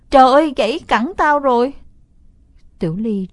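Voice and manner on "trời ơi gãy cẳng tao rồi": loud, higher cry of fake pain; fake pained yell. Boy fakes a broken leg to lure his sister, loud fake yell. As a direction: ham up a broken-leg scream. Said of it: "ôm giò hét ầm lên".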